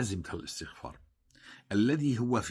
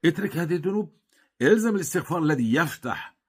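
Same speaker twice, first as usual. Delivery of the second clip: much higher and louder preaching cry; fervent shout; 8 semitones higher, 8 dB louder than usual. Acted. Preacher urging listeners to quit their sins, shouting. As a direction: thunder the command at the crowd.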